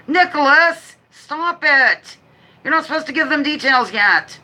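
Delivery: playful high scold, voice pushed up; mock scolding. Host teasingly scolding the birthday boy Nicholas in chat, mock stern. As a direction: tease him like a fussy aunt.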